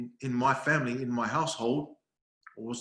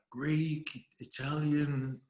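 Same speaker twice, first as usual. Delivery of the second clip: ordinary talk, nothing marked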